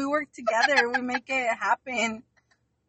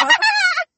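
high laugh; 7 dB louder than usual. Real, not acted. Laughs at own joke.

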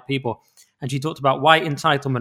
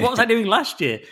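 laughing; 8 semitones higher than usual. Real, not acted. Laughing at own busy year.

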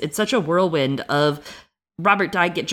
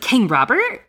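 high, rising, baffled question; surprised Arya; 8 semitones higher, 4 dB louder than usual. Retold or imitated. Imitating Arya asking about the king, high baffled. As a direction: ask it in sheer confusion. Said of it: "Arya's like".

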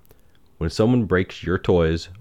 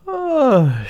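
high, drawn-out mock yawn; pitched-up acted yawn; 4 dB louder than usual. A narrator's reading. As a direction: yawn it out as a sleepy girl.

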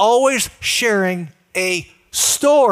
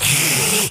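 imitated noisy sucking sound; mock slurp of food being inhaled; rushing suck of air, wolfing it down; 5 semitones lower than usual.

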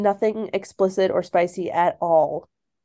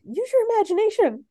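teasing; 12 semitones higher than usual. Real, not acted. Teasing reader about fade out.

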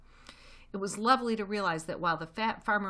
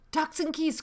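put-on high, loud young voices; excited cry; 11 semitones higher, 6 dB louder than usual. A narrator's reading. Small foxes guess what's above them, crying out high. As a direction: pipe up with greedy glee. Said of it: "cried the small foxes".

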